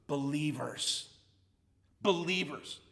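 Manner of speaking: BELIEVERS dropped low and soft for weight, then repeated; hushed, low-pitched stress on each "Believers"; emphatic repetition. Real, not acted. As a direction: lean on "Believers" by saying it low, quiet and deliberate. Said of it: "Why do I keep emphasizing the word".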